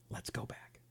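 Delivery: soft unvoiced whisper. Real, not acted. Host cues the clip back to his listeners, whispered. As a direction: breathe it as a secret aside. Aside before resuming clip.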